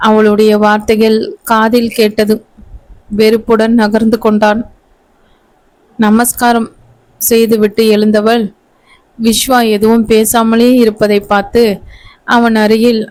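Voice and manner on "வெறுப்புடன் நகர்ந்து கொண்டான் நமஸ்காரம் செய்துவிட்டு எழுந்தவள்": ordinary delivery, nothing marked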